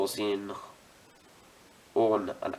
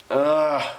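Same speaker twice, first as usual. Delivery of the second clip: loud, higher "ugh" of exasperation; frustrated groan; 4 semitones higher, 4 dB louder than usual. Real, not acted. Hobbyist losing a part mid-build, exasperated groan.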